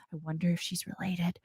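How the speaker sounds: soft whisper under the breath; hushed side thought; whispered aside. Real, not acted. Whispered aside while reading lineup.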